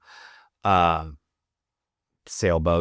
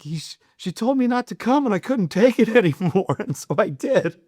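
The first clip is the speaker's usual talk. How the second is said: much higher, laughing, sheepish excuse; buddy making excuses to his friend, squeaky and giggling; panicked buddy; 14 semitones higher than usual. Retold or imitated. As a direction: blurt it out like a caught idiot, half laughing. Buddy explains while fleeing naked.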